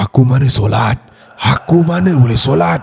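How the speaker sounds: loud, menacing demon rasp; hoarse possessed growl. Retold or imitated. Possessed child defying mother over prayer, hoarse growl. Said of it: "Suara dia memang garau gila".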